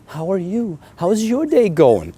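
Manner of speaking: high, timid small talk; mock your own weak, eager-to-please voice; meek, permission-seeking. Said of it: "I communicated like a cuck".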